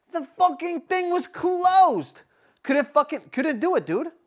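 high, loud outraged complaint; worked-up reliving of the letdown; retold exasperation. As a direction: raise pitch and volume in outrage. Retells discovering submission was closed.

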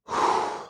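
long, breathy exhale; heavy sigh. Real, not acted. Pausing over Cuban sandwiches.

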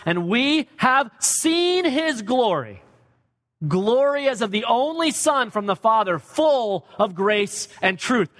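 preaching at full force. Real, not acted. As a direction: proclaim each word. Preacher reads climactic verse.

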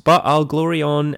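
ordinary talk

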